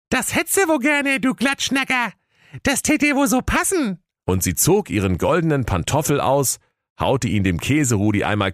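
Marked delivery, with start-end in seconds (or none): 0.1-4.0 very high-pitched scornful heroine; Aschenursel calling out. A narrator's reading. Aschenursel rebuffing Käserudi, high indignant squeak. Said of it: "Da rief das Aschenursel".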